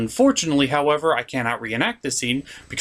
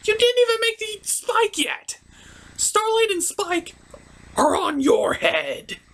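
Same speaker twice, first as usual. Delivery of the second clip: much higher, scolding pony; high mocking pony voice. Pony scolding the helper for the missing Starlight and Spike, high. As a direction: scold them in a squeaky high voice.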